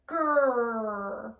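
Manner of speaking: growled grrr; bear growl. Shown as a demonstration. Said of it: "Growl like a bear".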